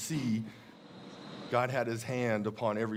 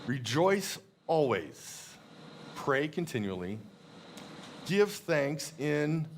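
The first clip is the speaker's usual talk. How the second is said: very slow, weighted verse reading; slow reverent reading of the verse; scripture reading; slower than usual. Acted. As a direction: savour every phrase of the verse. Reads Thessalonians verse aloud.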